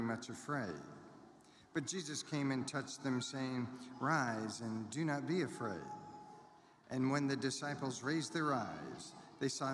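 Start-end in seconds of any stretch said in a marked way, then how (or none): none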